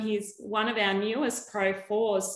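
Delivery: ordinary talk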